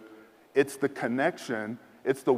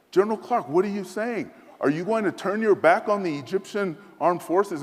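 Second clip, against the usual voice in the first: raised, higher mock-indignant general; 8 semitones higher than usual. General confronting the American officer, higher and indignant. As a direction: voice the general's outraged challenge.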